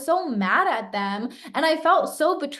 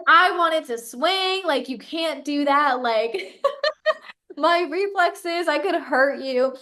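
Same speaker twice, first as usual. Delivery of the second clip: laughing retelling; 5 semitones higher, slower than usual. Retold or imitated. Laughing while retelling boyfriend's warning. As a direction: giggle through his words.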